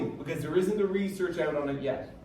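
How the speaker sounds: usual speaking voice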